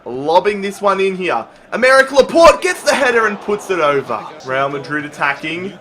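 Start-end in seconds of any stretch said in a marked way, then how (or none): none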